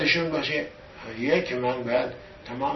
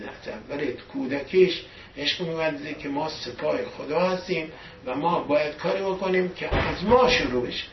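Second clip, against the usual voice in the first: normal talking voice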